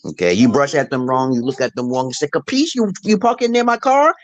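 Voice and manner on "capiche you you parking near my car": high-pitched imitation Italian gangster; mock Italian tough guy. Ruthless Italian confronting someone parking near his car, mock menace. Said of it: "Italians were very ruthless back in those days".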